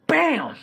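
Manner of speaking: high, loud "bam" sound effect; sudden explosive mimicked bang; imitated loud bang. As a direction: punch out a big noise. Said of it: "they heard a big-ass noise going".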